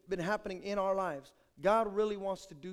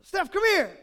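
calling out; 10 semitones higher, 10 dB louder than usual. Retold or imitated. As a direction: call little sister down sweetly. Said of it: "I go".

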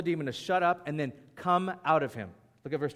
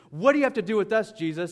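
loud, quick, higher-pitched mock challenge; acted demoniac outburst; 4 semitones higher, 6 dB louder, faster than usual. Demoniac stands up to challenge Jesus, acted outburst. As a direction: blurt it out as the imitated demoniac.

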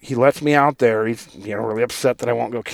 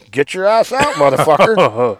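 mock wife snarl; 4 semitones higher, 6 dB louder than usual. Wife throwing drunk husband out, mock snarl. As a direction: spit it at him as she dumps him.

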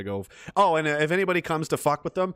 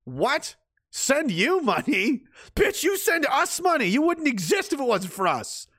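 incredulous retort; 13 semitones higher, slower than usual. Acted. Incredulous retort to the mocked quote.

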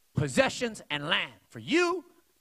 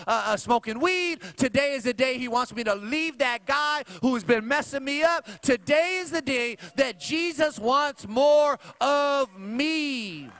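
higher, louder preacher's build; full-force preaching; 6 semitones higher, 5 dB louder than usual. Acted. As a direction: drive each line home to the congregation.

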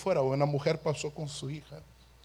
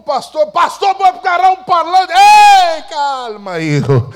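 hammed-up pastor on a loudspeaker, high and loud; mock pastor shouting; 12 semitones higher, 18 dB louder than usual. Young preacher teasing himself as a pastor, loud high megaphone yell. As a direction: boom it out like a preacher on a speaker.

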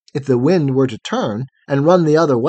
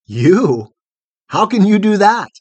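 loud, higher disbelieving question; incredulous; 6 semitones higher, 6 dB louder than usual. A narrator's reading. Photogen doubts she can take care of him, incredulous. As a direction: throw it back in disbelief. Sceptical reply to her promise.

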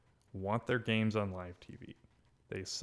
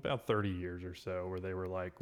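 usual speaking voice

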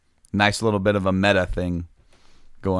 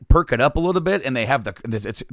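ordinary talk

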